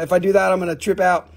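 ordinary talk

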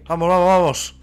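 loud urgent hurry-up; urgent shouting. Real, not acted. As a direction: push them to run, loudly. Fleeing pursuers.

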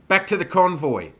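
loud, high battlefield command; shouted order. A narrator's reading. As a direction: call out to rally the riders. Leader calls riders back in battle.

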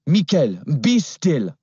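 put-on calm the struggling boy in a French voice; French girl. Girl on the scooter ordering the boy, French accent. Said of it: "The girl said".

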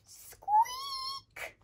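very high, loud squeal of a bat; piercing, long high squeak. Acted. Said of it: "he heard a familiar sound".

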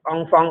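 อองฟอง said the French way, nasal vowels; French pronunciation. Shown as a demonstration. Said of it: "ภาษาฝรั่งเศสนะ".

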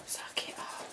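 whispered, barely voiced. Real, not acted. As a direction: say it under your breath. Whispered while filming.

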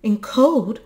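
high, loud lament; pitying wail, voiced by a narrator; distressed cry. A narrator's reading. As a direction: cry out in dismay for him. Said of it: "and she cried".

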